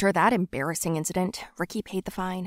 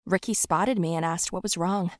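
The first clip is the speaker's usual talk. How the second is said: ordinary delivery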